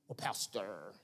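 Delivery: low, grave call to the back room; deacon summoning. Retold or imitated. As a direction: deacon pulling the pastor aside, low and hushed. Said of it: "they call you to the back".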